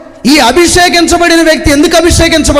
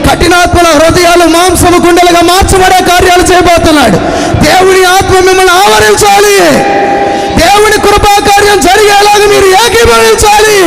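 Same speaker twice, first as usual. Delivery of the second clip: preaching at full force; 7 semitones higher than usual. Acted. Pastor proclaiming to the congregation, building to a shout.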